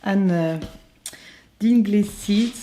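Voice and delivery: English title with a French accent. Real, not acted. Vlogger names a favourite saga to viewers, French-accented English. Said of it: "Pardonnez mon accent anglais".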